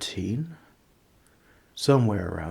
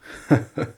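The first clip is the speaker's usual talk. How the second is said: smug chuckle; 4 semitones higher, 4 dB louder than usual. Acted. Laughing at own cleverness.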